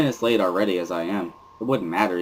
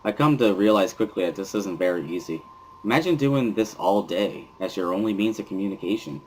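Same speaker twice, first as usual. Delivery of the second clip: ordinary delivery, nothing marked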